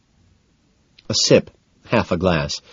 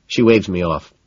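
ordinary delivery, nothing marked

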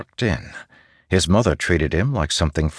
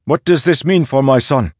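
alarmed father; 9 semitones higher, 7 dB louder than usual. A narrator's reading. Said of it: "Father asked".

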